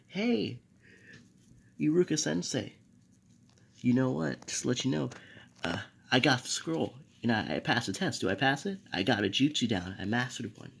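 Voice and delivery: light acting of a kid hailing the teacher; excited greeting. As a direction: burst in with proud news. Naruto excitedly greets his teacher.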